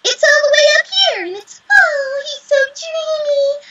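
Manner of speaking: put-on very high, pinched, swooning girl voice; Momoko voice. Shown as a demonstration. Actress demonstrates her character's secret high voice, dreamy. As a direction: squeeze the throat and swoon way up high. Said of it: "and my Momoko voice".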